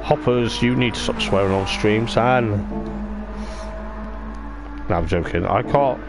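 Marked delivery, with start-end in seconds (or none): none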